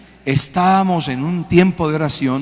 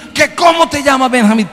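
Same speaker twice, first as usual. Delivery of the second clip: high, raised-voice retold demand; exasperated questioning; 9 semitones higher, 5 dB louder than usual. Exorcist keeps demanding a demon's name, exasperated. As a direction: press the question again, pitch way up.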